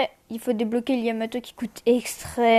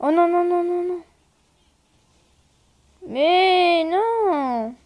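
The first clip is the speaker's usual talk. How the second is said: désespoir soudain; 6 semitones higher, 3 dB louder, much slower than usual. Real, not acted. Mort en début de partie.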